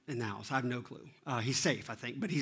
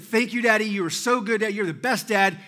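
high, loud mock-grateful kid; gushing thanks thrown back, pitched up; imitated gushing; 7 semitones higher, 6 dB louder than usual. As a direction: parrot a child's over-the-top praise. Dad mimics son's earlier gushing.

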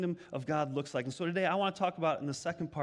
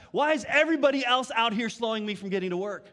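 mock griping driver, louder; impatient driver; 5 semitones higher, 6 dB louder than usual. Pastor voicing a driver annoyed by traffic, mock gripe. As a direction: whine like a driver stuck in traffic.

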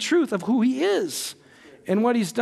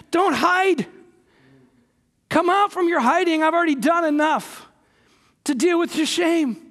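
high, insistent preacher's appeal; urgent plea; 9 semitones higher than usual. Acted. God calling the preacher out of hiding, pleading. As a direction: call someone out of hiding, pitched high.

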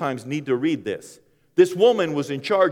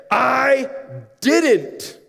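loud denial; 11 semitones higher, 7 dB louder than usual. Acted. Peter's flat denial.

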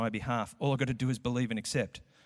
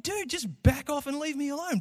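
high, fed-up student voice; mock plea to be left alone; exasperated student; 12 semitones higher than usual. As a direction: brush them off, exasperated. Student voiced pushing back.

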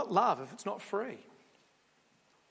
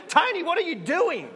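calling after Tony; 8 semitones higher, 4 dB louder than usual. Pretend. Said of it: "We're like".

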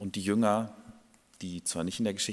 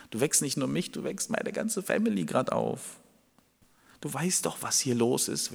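exasperated friend, pitch raised; 5 semitones higher than usual. Acted. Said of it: "der Freund, bei dem gerade angeklopft wurde".

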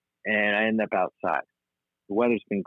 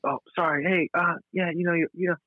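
higher, friendly apology voice; sweetly apologetic to a client; polite work voice; 7 semitones higher than usual. Retold or imitated. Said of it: "I'm like".

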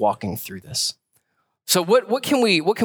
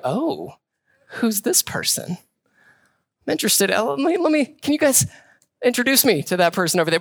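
lightly high, eager and interested; a hint of a smitten suitor; smitten Boaz; 9 semitones higher than usual. Mock smitten Boaz asking workers about her, lightly high. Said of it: "Boaz kinda going like".

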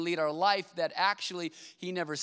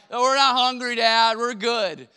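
put-on loud, higher voice for a child; kid's voice; 4 semitones higher, 8 dB louder, slower than usual. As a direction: voice the daughter, mouth full of Cheetos. Daughter with Cheetos voiced.

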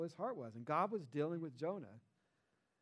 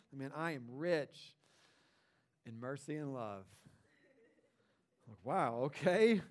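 awed resume reading; 4 semitones lower, slower than usual. Pretend. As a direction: read it like a recruiter stunned by a resume. Reading God's resume aloud.

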